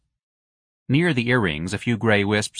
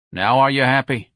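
louder, dry husband's jab; weary husband; 4 semitones higher, 4 dB louder than usual. A narrator's reading. Husband needling his wife about her project. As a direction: needle your wife wearily.

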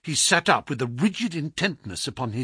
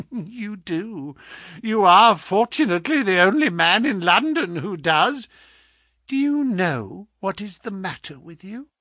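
high-pitched, self-satisfied questioning; preen, then probe the patient; smug visitor; 6 semitones higher than usual. Acted. Visitor boasts to the sick man, smugly.

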